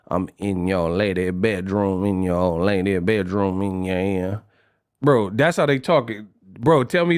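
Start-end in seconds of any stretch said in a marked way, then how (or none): 0.1-4.4 B.G. impression. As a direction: imitate B.G., low and slurred New Orleans. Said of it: "I'ma say it like B G".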